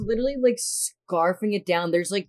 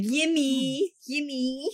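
put-on high, sing-song Spanish accent saying "Yimmy"; imitating a Spanish-accented woman calling "Yimmy", high and rising; Estefania's accent; 10 semitones higher, much slower than usual. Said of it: "the way she says Jimmy with her accent".

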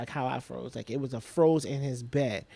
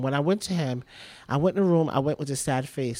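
ordinary talk

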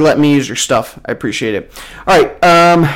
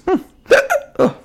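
holding back a hiccup; stifled hiccup then a laugh; suppressing hiccups; 14 semitones higher, 3 dB quieter than usual. Real, not acted. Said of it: "sorry, hiccups".

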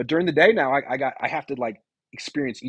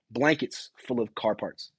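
ordinary talk, nothing marked

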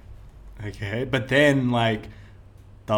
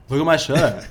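mildly raised voice, repeating for attention; calling out; 3 semitones higher, 5 dB louder than usual. Retold or imitated. Fan to distracted [name], calling out louder. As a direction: call it out to someone who missed it.

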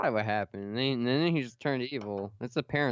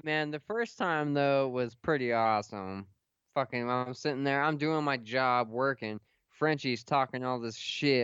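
ordinary talk, nothing marked

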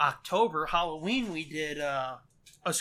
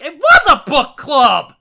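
loud, slow, disbelieving shout; exasperated shout; 7 semitones higher, 17 dB louder than usual. Retold or imitated. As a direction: yell it, fed up. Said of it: "And it's like".

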